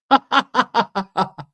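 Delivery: high, loud burst of laughter; real high-pitched belly laugh. Real, not acted. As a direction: crack up at yourself in a high pitch. Laughing after "am I thick.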